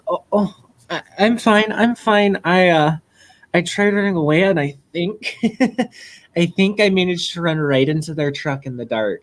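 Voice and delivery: embarrassed "I'm fine" with a little laugh; sheepish. Acted. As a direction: brush it off sheepishly, with halting starts. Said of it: "running her hand through her hair sheepishly".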